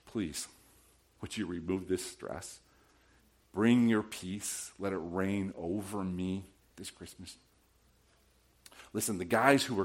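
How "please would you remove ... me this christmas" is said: soft, low, hushed prayer to the Lord; quiet prayer. Retold or imitated. Pastor models a quiet prayer to God, soft and hushed. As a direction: pray it gently, almost under your breath.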